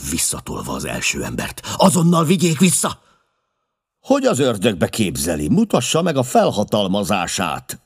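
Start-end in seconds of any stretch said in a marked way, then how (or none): 1.8-3.0 very high, loud command; forceful, high-pitched order; insistent shout. A narrator's reading. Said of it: "kiáltotta". 4.0-7.8 indignant, raised-voice demand; furious challenge, pitched up; angry yell. A narrator's reading. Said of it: "ordította a dühös Peters".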